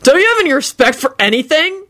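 high-pitched appalled question; incredulous yell. A narrator's reading. Henry scolding Kenny, incredulous. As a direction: demand it in disbelief. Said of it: "Henry yelled incredulously".

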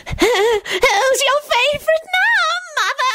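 very high, slow deranged screech; manic, stretched-out high taunt; crazed scream. A narrator's reading. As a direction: scream it through a crazed grin. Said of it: "screamed with a horrifically crazed grin".

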